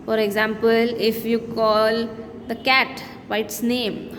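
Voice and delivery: ordinary talk, nothing marked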